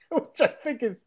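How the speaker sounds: laughing while saying it. Real, not acted. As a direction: say it through a laugh. Laughing at the line.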